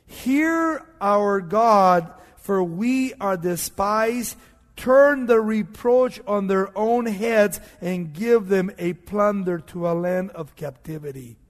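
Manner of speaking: loud, slow, drawn-out declaimed prayer; solemn plea proclaimed at preaching volume; praying aloud. Retold or imitated. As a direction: call out to God with big stretched words. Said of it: "He prayed".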